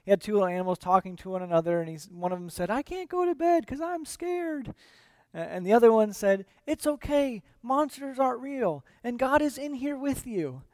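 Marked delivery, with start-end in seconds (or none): 2.7-4.7 high, small, frightened toy voice; scared little animal. Retold or imitated. Toddler voices a scared bath toy. 6.7-10.6 lightly high, soothing toy voice; a small toy gently calming its friend; comforting little animal. Retold or imitated. Second toy reassures the first.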